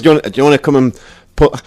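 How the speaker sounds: fast, loud, lightly put-on Northern Irish voice; imitating a Northern Irish accent. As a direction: mimic the friendly Northern Irish guide at the Guinness factory. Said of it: "why am I talking like that?".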